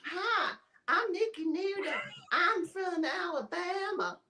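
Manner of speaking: slow, drawn-out mock Southern accent. Nikki introducing herself as if from Alabama, slow mock Southern accent. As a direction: play up a slow Alabama twang. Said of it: "I went straight to the South".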